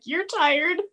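high, mock-indignant retort; Elliot's snippy comeback. Podcast host voicing Elliot's retort to Sophie, mock-indignant. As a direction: throw it back at her, offended. Elliot quoted, retelling episode.

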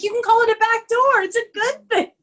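high, proud, pleased with himself; cocky Quinn voice. Retold or imitated. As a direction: pitch it way up like a gleeful show-off. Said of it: "And he's like".

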